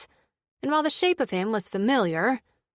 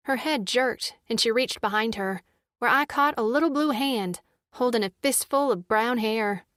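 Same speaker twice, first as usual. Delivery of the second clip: plain reading voice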